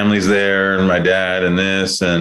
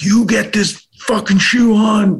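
much higher, aggressive retold scolding; angry at toddler. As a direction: force the words out like you're cramming a shoe on a kid. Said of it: "with aggression and anger".

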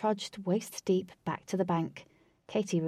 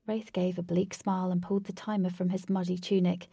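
plain reading voice

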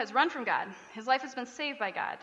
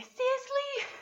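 put-on high little-kid voice, much higher; toddler imitation; slower than usual. Mom imitating daughter answering dude with a squeaky seriously. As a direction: squeak it like a tiny child. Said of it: "and she'll say".

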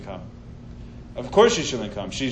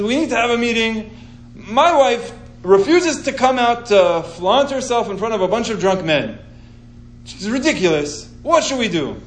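mock-outraged husband, higher voice; raised, emphatic mock indignation; sarcastic; 6 semitones higher than usual. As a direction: sarcastically play up the indignant complaint. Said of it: "I hope you could sense the sarcasm".

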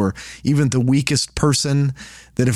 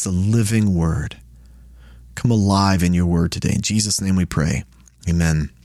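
prayer; 4 semitones lower than usual. Real, not acted. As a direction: pray humbly before the study. Leads an opening prayer.

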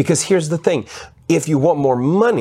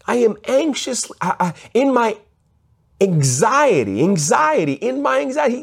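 higher, faltering anxious plea; a king confessing his anxiety, higher and halting; 11 semitones higher than usual. Acted. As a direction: stumble through it like a worried king. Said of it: "you can see how he changes his tone".

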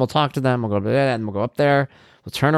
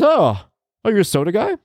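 higher, teasing boss voice; gym owner quote; 10 semitones higher than usual. Retold or imitated. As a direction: needle the new guy from up high. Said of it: "he goes".

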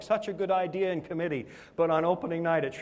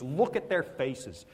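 usual speaking voice

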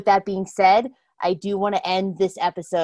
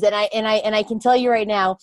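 usual speaking voice

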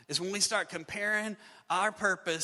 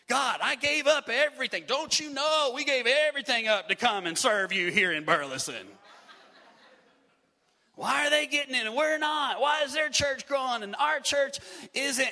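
raised, pleading protest to God, higher and louder; indignant prayer; 6 semitones higher, 5 dB louder than usual. Retold or imitated. Pastor re-enacting his jealous prayer, loud indignant protest. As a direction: cry out to God that it's unfair, full voice.

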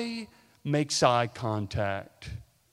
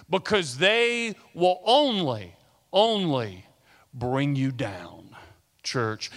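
usual speaking voice

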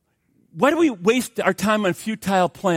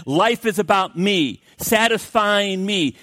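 ordinary talk, nothing marked

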